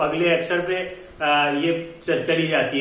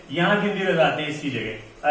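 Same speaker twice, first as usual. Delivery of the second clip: usual speaking voice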